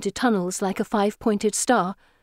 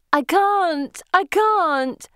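high, wailing protest; desperate cry; 9 semitones higher, 3 dB louder, much slower than usual. A narrator's reading. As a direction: cry it out helplessly, drawn out. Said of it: "Anne cries".

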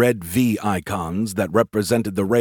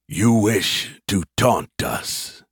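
alien growl; much slower than usual. Acted. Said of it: "an alien voice growled".

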